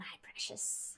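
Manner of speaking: low hissy precious; Gollum voice. Acted. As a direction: clutch it like Gollum. Gollum quote over straw.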